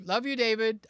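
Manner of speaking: high, lively greeting; high affectionate greeting. Real, not acted. Streamer greeting a buddy in chat, high and affectionate. First take, before the masculine redo.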